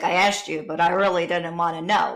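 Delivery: imitating a careless passerby; mock indifferent. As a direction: toss it off like you don't care. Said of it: "some people laugh and they're like".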